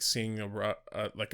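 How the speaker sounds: ordinary talk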